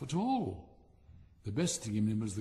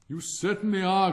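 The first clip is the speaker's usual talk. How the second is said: cried out; 6 semitones higher, 9 dB louder than usual. Acted. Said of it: "cried the Bengal light".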